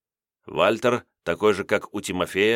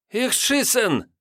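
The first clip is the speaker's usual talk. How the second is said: German soldier; much slower than usual. A narrator's reading. German soldier, high slow.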